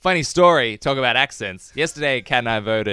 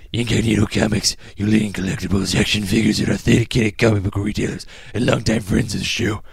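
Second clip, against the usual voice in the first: steady, measured announcer-style ad read; podcast host reading sponsor copy; 5 semitones lower than usual. Acted. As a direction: read the copy evenly, selling to listeners. Reads the sponsor ad.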